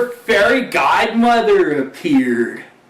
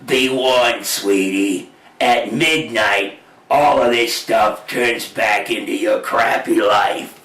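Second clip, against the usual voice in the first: deep, slow warning godmother voice; a low, ominous warning, drawn out; fairy godmother; 10 semitones lower, slower than usual. Acted. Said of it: "the fairy godmother, he said to her".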